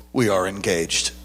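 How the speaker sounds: very low, grave imitation of a pastor; understated, weighty pronouncement; solemn declaration. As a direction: declare it deep and serious. Quoting pastor stopping to answer.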